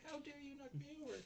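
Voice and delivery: very high, squeaky indignant imitation; mock blaming. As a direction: accuse him in a hammed-up squeal. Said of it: "blaming him".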